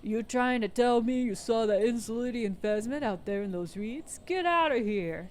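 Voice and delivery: put-on voice of a scoffing boy; mock a boy's disbelief, dragging the last words; imitating a boy. Old woman mimics a boy's disbelief, put-on boy voice. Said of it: "she lowers her voice, imitating a boy".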